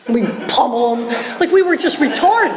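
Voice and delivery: laughing high, self-mocking. Real, not acted. Speaker mocking his younger self, laughing.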